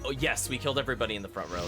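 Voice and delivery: ordinary talk, nothing marked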